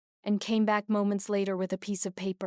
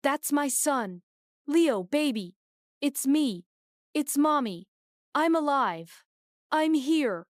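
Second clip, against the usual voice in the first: desperate pleading; 6 semitones higher, 4 dB louder than usual. A narrator's reading. Mother pleads to scared son.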